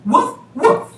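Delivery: high, loud woofs; a couple of cheeky barks; dog bark. Acted. Mommy Mouse barks.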